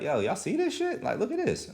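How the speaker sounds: high, incredulous internet poster; excited tweet. Retold or imitated. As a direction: play the outraged guy, way up high. Dude's tweet voiced in retelling.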